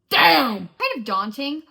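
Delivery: sharp loud damn; loud exclamation. Real, not acted. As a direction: blurt it out loud. Reaction to many books.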